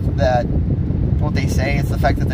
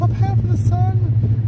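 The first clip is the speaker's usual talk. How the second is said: high, slow sing-song; drawn-out high mock voice; high put-on voice; slower than usual. Echoing phrase in put-on voice.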